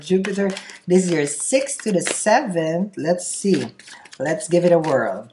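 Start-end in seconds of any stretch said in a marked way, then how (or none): none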